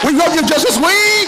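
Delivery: high, loud fan cheer; shouting. Real, not acted. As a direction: yell it from the crowd. Fan cheering at panel close.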